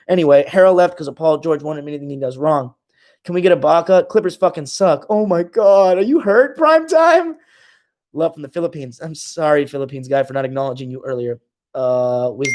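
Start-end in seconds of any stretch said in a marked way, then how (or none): 5.1-7.4 high, louder fake pity; mock concern. Streamer taunting Prime Time with exaggerated fake concern. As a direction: coo at him like a hurt baby. Streamer mocks the commenter's hurt feelings.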